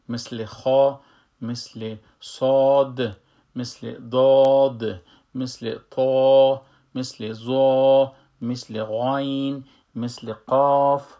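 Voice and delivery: heavy, long-held letter names from خا to قاف; full, heavy letters. Shown as a demonstration. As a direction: punch and stretch each letter name, full-mouthed, as in صاد. (تلفظ پر و کشیدهٔ حروف مفخم مثل صاد)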